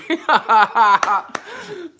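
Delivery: high loud cackle; burst of laughter. Real, not acted. Laughing hard at the poem he read. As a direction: crack up laughing.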